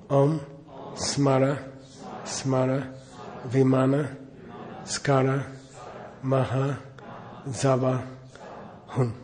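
slow, even chanting of each syllable; mantra recitation. Acted. Teacher leading students in mantra recitation, measured chant. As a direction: lead the group through the mantra.

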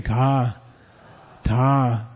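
low, voiced 'ka', 'ta'. Shown as a demonstration. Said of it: "it means low tone voiced".

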